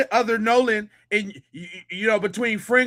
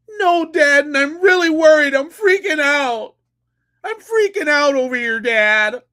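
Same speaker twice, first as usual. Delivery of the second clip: higher, louder hammed-up freak-out; hammed-up panicking son, higher and louder; mock panic; 6 semitones higher, 5 dB louder than usual. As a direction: overplay the scared son crying to dad. Mocking son's fake panic again.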